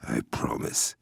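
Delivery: soft unvoiced vow; hushed whisper; whispered promise. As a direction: breathe the promise to her. Quiet vow to sister.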